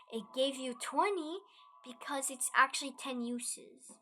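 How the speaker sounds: ordinary delivery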